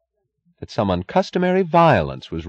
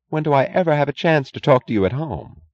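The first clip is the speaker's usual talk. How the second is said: understated reproach, higher in pitch; a mild, impassive complaint to a husband; impassive drawl; 8 semitones higher than usual. A narrator's reading. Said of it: "She was drawling her words impassively".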